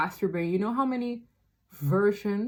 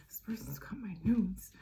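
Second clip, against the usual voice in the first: whispered worry; 8 dB quieter than usual. Retold or imitated. Voicing the viewer's worried thought.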